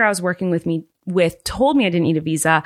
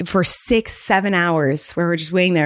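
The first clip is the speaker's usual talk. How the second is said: normal talking voice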